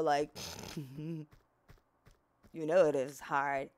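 ordinary talk, nothing marked

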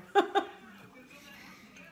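high burst of laughter; real laugh. Real, not acted. Teasing about Jamie's Twitter, laughing high. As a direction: crack up, high-pitched.

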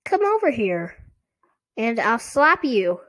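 a hint of a ventriloquist's dummy voice; squeaky dummy. A narrator's reading. Dummy threatens the doubting sister, lightly squeaky puppet voice. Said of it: "Lindy made him say".

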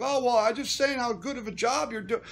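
high, loud mock protest; loud, whiny-defensive retelling; imitated defensive flatterer. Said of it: "And the guy's like".

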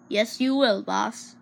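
ordinary talk, nothing marked

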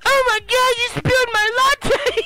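very high, loud mock shriek; freaking out. Oscar freaking out over his spilled latte, high shriek. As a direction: squeal it in hammed-up hysteria. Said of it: "He's freaking out".

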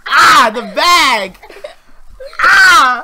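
high, slow disgusted groan; disgusted cry. Real, not acted. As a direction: recoil from the smell out loud. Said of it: "it smells awful".

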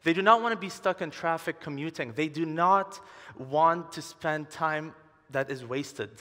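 ordinary talk, nothing marked

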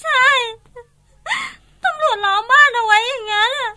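crying. Acted. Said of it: "เอ็งจะร้องไห้ทำไมวะ".

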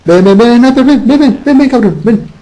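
high, doting pet-calling voice; baby talk. Pretend. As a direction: coax the pet over lovingly.

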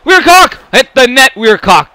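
high, loud shouts at the screen; frustrated yelling. Real, not acted. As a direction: yell at the player to shoot. Yelling at own player.

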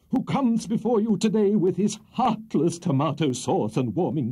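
milk the jury like a grandstanding lawyer; pompous courtroom orator. A narrator's reading. Buzfuz's closing speech to jury.

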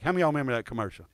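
ordinary talk

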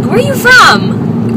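high, drawn-out quoted question; curious stranger. Retold or imitated. Someone at yoga asking about her accent, mock curious. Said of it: "someone was like".

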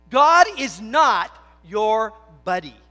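loud, slow, emphatic proclamation on God; forceful preaching. Acted. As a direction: boom it out from the pulpit, slowly. Preached declaration, then repeated plainly.

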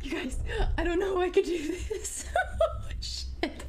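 high, wailing laugh-cry; scared words collapsing into high laughter; hysterical nervous laughter. Real, not acted. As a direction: talk through a helpless laughing fit. Overwhelmed by fear, laughing helplessly.

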